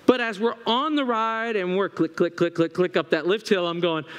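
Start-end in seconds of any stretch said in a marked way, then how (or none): none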